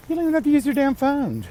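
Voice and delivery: exasperated rant. Real, not acted. Ranting about texting instead of calling.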